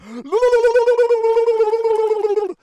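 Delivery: real loud scandalised gasp; loud shocked gasp. Real, not acted. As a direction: gasp in outrage at the donation. Reacting to a crude Super Chat.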